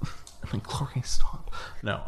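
unvoiced whisper through giggles; whispered plea to a giggling friend. Real, not acted. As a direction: hush her between laughs. Stifled giggling whisper to cohost.

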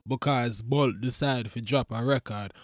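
a hint of a patois news read; mock Jamaican radio. Comic on his podcast doing a lightly Jamaican news read.

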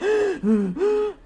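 high, strained imitated gasp for air; a quick hammed-up wheeze; mock wheezing gasp. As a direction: act out a patient wheezing. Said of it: "I don't care if they wheezing".

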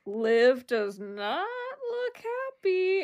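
slow, rising to high, drawn-out observation; slow gossip commentary. Retold or imitated. Housemate watching Liv, slow drawn-out gossip. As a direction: savour it like juicy news. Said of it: "someone else goes".

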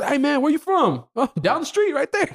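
high-pitched put-on accented chat; retold exchange with strangers. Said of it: "they're just".

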